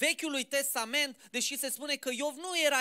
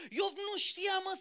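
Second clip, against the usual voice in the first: emphatic shout; 8 semitones higher, slower than usual. Real, not acted. Preacher's emphatic point.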